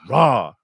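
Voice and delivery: "raw" called loud with a breathy H ending; demonstrated exclamation. Shown as a demonstration. Teaching friend the slang exclamation, "raw" punched loud. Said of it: "The first H has to sound like the end".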